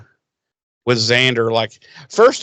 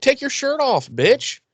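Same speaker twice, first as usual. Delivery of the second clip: high-pitched sassy comeback; mock defiant retort; 11 semitones higher than usual. Host voicing Buffy's retort to Riley, mock defiant. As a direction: throw the order back, hammed-up.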